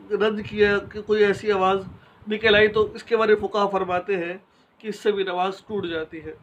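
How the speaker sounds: normal talking voice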